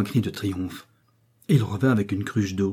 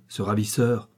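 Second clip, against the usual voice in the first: ordinary delivery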